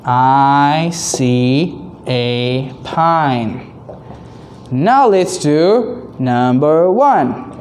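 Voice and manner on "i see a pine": slow reading aloud. Shown as a demonstration. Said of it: "Can you say it".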